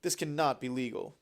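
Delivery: usual speaking voice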